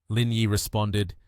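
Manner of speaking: ordinary delivery, nothing marked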